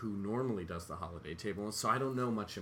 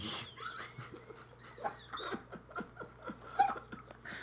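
high squealing burst of laughter; 5 dB quieter than usual. Real, not acted. Big laugh at the bit.

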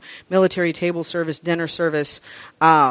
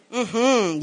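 ordinary delivery

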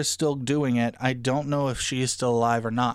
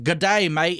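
a bad, hammed-up Australian accent, a bit louder; mock Australian accent; 4 semitones higher, 4 dB louder than usual. Mocking the movie's bad Australian accent to a co-host. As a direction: mimic the film's poor Australian twang. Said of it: "really wanna hear bad Australian accents".

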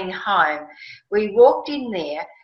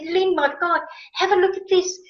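much higher, thrilled; excited call; 9 semitones higher than usual. Retold or imitated. To her friend Lynn on finding the salt, a high thrilled call. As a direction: call her over, overwhelmed with joy.

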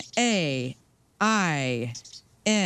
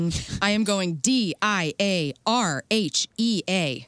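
ordinary talk

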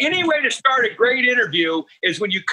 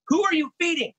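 imitating wife; 8 semitones higher than usual. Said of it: "She goes".

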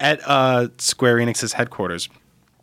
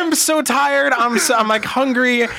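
animated; 12 semitones higher, slower than usual. Retold or imitated. Retelling exhausted state.

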